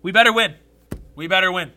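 loud, punchy insistence; emphatic demand. Real, not acted. Fan demanding a win tonight, emphatic. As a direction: demand it forcefully, a pause between repeats.

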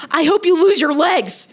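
high, loud mock attack; aggressive commenter. Host imitating online haters, loudly aggressive. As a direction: hurl it as a hammed-up hater. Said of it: "so aggressive what people will say".

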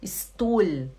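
"Stuhl" as is-tool; foreigners' wrong s. Retold or imitated. Teacher imitating beginner foreigners, "Stuhl" as is-tool. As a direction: say "Stuhl" with a plain s and a vowel before it. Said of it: "a maioria dos estrangeiros".

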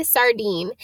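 ordinary delivery

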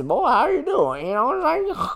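high, loud, put-on voice calling out a name; high mock greeting. Greeting Jesse out of nowhere in a high, loud, put-on voice. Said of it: "And he goes".

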